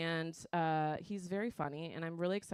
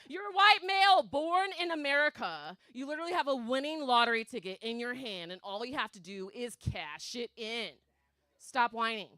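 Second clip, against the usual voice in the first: scolding rant; 5 semitones higher, 5 dB louder than usual. Acted.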